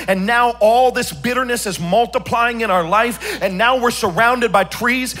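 loud, half-sung preaching build; full-force preaching. Acted. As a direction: preach it out over the music, singing the lines. Preaching about bitterness and wasps.

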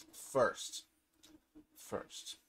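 hushed, breathless whisper. Acted. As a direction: whisper it as a secret. Building suspense before crafting.

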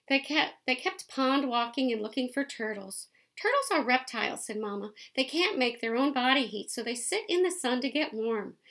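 lively picture-book read-aloud; reading to children. A narrator's reading. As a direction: read it out to the kids, bright and clear.

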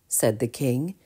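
ordinary delivery, nothing marked

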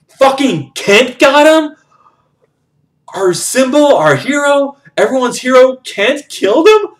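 high, loud, incredulous outburst; outraged disbelief. Real, not acted. Storyteller outraged that Kent killed the hero, high and loud disbelief.

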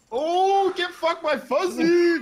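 high, loud and slow gloating yell; teasing a player by echoing his gloating shout; mock gloating shout. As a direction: crow over the win in a high mocking voice. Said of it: "You went".

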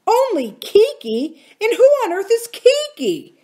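high, loud incredulous outburst; furious shout. A narrator's reading. Uncle Jocelyn furious at his smiling wife, shouting. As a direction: shout the questions in outrage. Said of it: "shouted Uncle Jocelyn, furious".

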